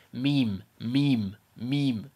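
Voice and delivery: English-accented "meme", heavier and shorter. Shown as a demonstration. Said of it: "say it like with an English accent".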